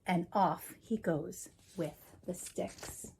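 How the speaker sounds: usual speaking voice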